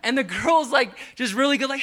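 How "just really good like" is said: usual speaking voice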